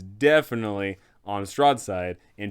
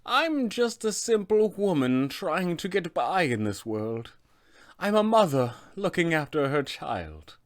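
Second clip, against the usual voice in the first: soft, high witch voice; Baba Lysaga; 6 semitones higher, 3 dB quieter than usual. Acted. Witch replying to curious players about who she is, soft humble crone voice. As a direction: play the humble old mother, high and gentle. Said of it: "She will reply simply with".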